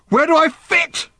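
very high, loud scream; sudden scream. A narrator's reading. Random to everyone while holding a gun, screaming. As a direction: scream it out in desperate anguish. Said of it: "screamed Random suddenly".

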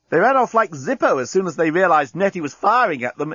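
high, lively enthusiastic exclamation. A narrator's reading. Corporal reporting the enemy's flight, enthusiastic. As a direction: report it with great relish. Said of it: "exclaimed Inchby Wiglet enthusiastically".

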